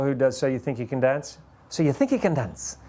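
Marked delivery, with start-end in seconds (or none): 1.7-2.8 a hint of a British host's accent on the show title; light British accent. Recalling the dance show's host, a light British accent. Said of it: "all these foreign accents".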